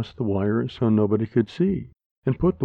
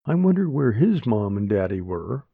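plain reading voice

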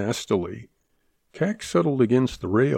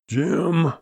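higher-pitched, mildly raised call; 7 semitones higher than usual. A narrator's reading. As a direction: summon him by name, voice lifted. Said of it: "Shorty mouthed".

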